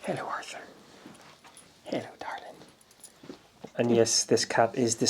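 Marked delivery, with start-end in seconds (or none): 0.0-0.6 soft whispered hello; whispering. Real, not acted. Whispering to the cat. 1.8-2.5 husky whispered endearment; femme fatale. Pretend. Whispering sweetly to the cat.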